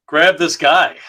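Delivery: excited comic narration. Acted. Reads the comic's action aloud excitedly.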